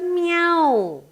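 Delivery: high-pitched, lightly acted meowing cat; cat's voice. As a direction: speak up high as a little cat asking to be fed. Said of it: "He says".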